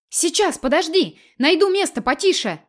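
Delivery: high, breathless calling out. A narrator's reading. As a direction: pant it out over the noise. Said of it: "запыхавшийся голос, как на бегу".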